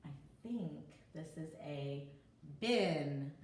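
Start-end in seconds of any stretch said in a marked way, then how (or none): none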